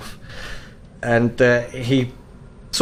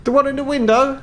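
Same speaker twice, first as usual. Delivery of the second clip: high-pitched elf shopkeeper voice; tall elf shopkeeper; 11 semitones higher than usual. Acted. Tall elf greets a customer entering the hunting shop, high-pitched. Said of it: "you're met by a very tall elf".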